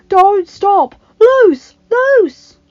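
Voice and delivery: very high, loud commander's yell; shouted battle orders. Acted.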